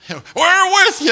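high, loud, slow sing-song gush; mock gushing. Preacher mocking fickle church members, sugary mock gush. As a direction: parody their syrupy loyalty.

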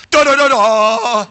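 loud sung da da da da; dramatic sting. Retold or imitated. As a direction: hum a courtroom drama sting. Dramatic courtroom music imitation.

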